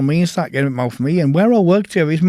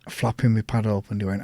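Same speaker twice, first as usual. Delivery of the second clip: usual speaking voice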